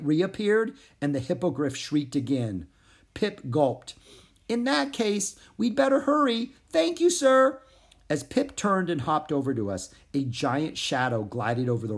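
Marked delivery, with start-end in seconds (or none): 4.5-7.6 high, rising, urgent frog voice; worried frog. Acted. Pip thanking the hippogriff, rising and urgent. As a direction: thank him and rush off.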